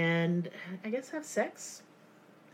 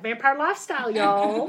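mocking emphasis; 2 semitones higher, 5 dB louder than usual. Shown as a demonstration. Host mocking the kids' phrase, laughing sarcastic emphasis. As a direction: sneer at "Vampire lifestyle" with a laugh. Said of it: "And I'm saying it like that".

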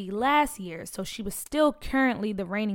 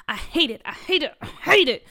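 growing frustration; 5 semitones higher, 5 dB louder than usual. Acted. Peach repeats in growing frustration.

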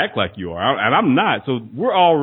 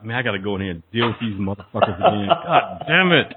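dreading groan; 5 semitones lower than usual. Retold or imitated. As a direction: mutter it like you dread the day. Dreading work in the car.

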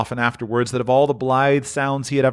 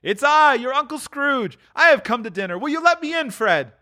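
very high, hopeful announcement; Scrooge, joyful. Acted. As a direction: announce yourself with high-pitched glee. Scrooge announces himself to his nephew.

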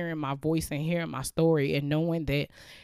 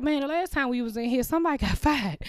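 higher joking worry, with a laugh; nervous staff voice; 9 semitones higher, faster than usual. Retold or imitated. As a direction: lightly voice the wary coworkers. Staff gossip in kitchen.

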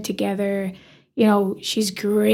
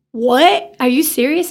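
shocked; 4 semitones higher, 5 dB louder, slower than usual. Retold or imitated. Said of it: "And I go".

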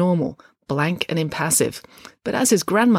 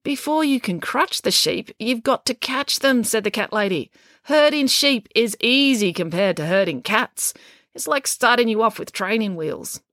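put-on higher, emphatic old lady explaining; lightly voiced blunt cat lady; 7 semitones higher than usual. A narrator's reading. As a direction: lay it out as if to a beginner.

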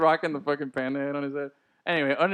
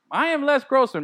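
higher, louder proud declaration; Les Grossman impression; 8 semitones higher, 4 dB louder than usual. Fantasy player declaring his team persona, high and proud. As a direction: announce it like the character. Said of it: "named after Tom Cruise's character".

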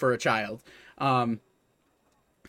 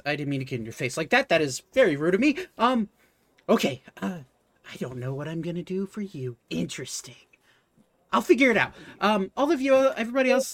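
flustered shopkeeper; 4 semitones higher, faster than usual. Acted. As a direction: fuss and apologize, then shoo the others off to browse. Shopkeeper apologizes, directs the group.

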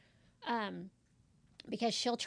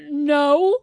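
imitated loud, higher-pitched correction; 4 semitones higher, 14 dB louder, much slower than usual. Man called Roberta correcting the caller, loud. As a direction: belt it out as the mistaken callee.

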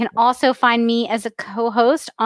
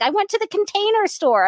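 excited quote; 8 semitones higher than usual. Retold or imitated. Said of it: "She's very excited about it".

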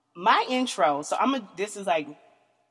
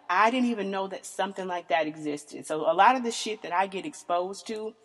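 ordinary talk

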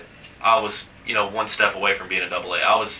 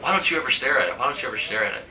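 lightly imitated Latin accent; 2 semitones higher, faster than usual. Teammate asking why he never stares at it, light Latin accent. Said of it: "his little Latin accent and stuff".

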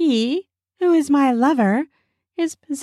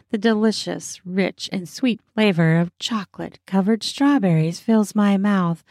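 ordinary delivery, nothing marked

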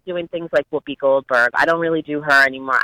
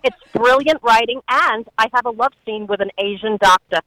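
a hint of brash Rosie, higher; Rosie O'Donnell impression; 7 semitones higher than usual. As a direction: play Rosie bragging about her show. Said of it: "Give us some Rosie".

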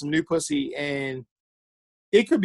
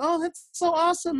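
fake enthusiastic voice; 13 semitones higher than usual. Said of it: "they're like".

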